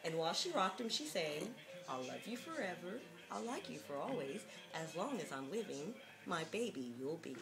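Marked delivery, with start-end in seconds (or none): none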